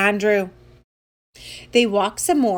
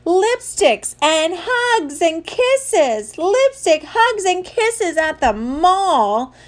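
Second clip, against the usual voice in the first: high, slow, drawn-out outrage; a kid's appalled, stretched-out protest; horrified yell; 7 semitones higher, much slower than usual. Acted. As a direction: wail each word in mortified disbelief. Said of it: "yelled Andrew".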